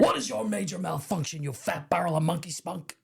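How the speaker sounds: mock drill-sergeant tirade; prison guard impression. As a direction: put on the tough guard's voice. Acting out Hadley's insult.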